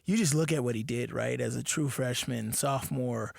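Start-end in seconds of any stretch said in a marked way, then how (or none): none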